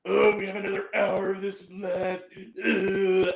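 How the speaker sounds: mock groan of a bored moviegoer; audible groaning complaint. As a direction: drag it out in an exaggerated, put-out groan. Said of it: "they were commenting, going, audibly going".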